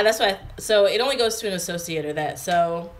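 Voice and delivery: usual speaking voice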